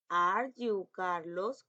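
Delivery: very slow, deliberate question; slow dictation. Shown as a demonstration. Teacher modelling the question slowly for primary pupils. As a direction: lay out each word for the class. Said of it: "teacher reads model question".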